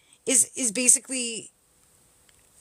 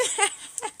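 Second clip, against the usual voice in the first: real high-pitched giggle; amused high giggle; 10 semitones higher than usual. Real, not acted. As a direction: laugh at your own joke. Laughing at own taunt.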